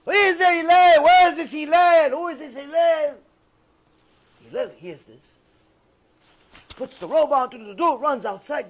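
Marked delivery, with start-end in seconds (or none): 0.1-3.2 high, loud demanding holler; screaming, full lungs. Retold or imitated. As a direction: bellow it like he owes you money. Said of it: "He's screaming at the top of his lungs".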